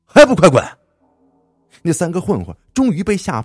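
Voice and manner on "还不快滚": explosive, high shout; very loud intimidating yell; loud roar. A narrator's reading. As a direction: roar to send them running. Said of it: "大声地冲他们一声吼".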